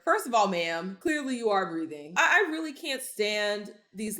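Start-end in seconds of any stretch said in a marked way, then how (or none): none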